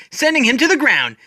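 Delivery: ordinary delivery, nothing marked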